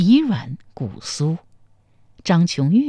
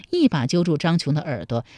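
usual speaking voice

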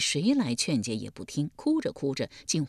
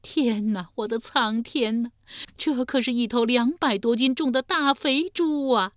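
loud, high lament that keeps climbing; an old woman crying out to the sky over her ruined feast; wailing to heaven; 6 semitones higher, 3 dB louder than usual. Acted. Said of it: "呼天闯地地说". (呼天抢地地哭喊)

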